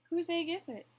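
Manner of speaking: usual speaking voice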